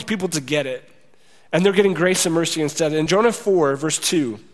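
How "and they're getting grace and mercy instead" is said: normal talking voice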